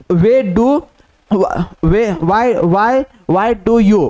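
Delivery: each word stretched out; slow dictation. Shown as a demonstration. Teacher dictates the phrase slowly before explaining it. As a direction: spell it out slowly for the class to write. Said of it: "teacher dictating a shorthand phrase".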